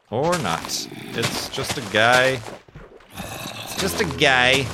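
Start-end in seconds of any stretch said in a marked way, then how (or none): 3.2-4.7 slow, drawn-out goofy voice; silly put-on voice. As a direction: repeat it in a goofy character voice. Repeats the line in a put-on voice.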